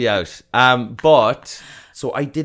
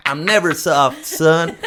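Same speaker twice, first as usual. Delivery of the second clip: a bit higher, louder dude-bro voice; mock caveman bro; 6 semitones higher, 4 dB louder than usual. Homo erectus bragging to his son, in loud dude-bro voice. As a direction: brag like a dumb caveman.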